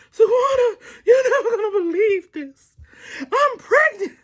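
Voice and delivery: high mock sobbing phone call; hammed-up tearful squeal; hysterical crying. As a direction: wail the news to a friend. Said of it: "I am hysterical".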